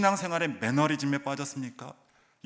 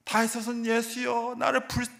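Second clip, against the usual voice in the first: mock crying out; 9 semitones higher than usual. Blind man calls out to Jesus, a mock pleading cry. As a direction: beg Jesus with a raised voice. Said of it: "소리를 지르기 시작해요".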